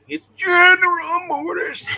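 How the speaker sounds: high, slow, booming shout; mock shout. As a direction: belt it out with drawn-out words. Shouted mock correction to others.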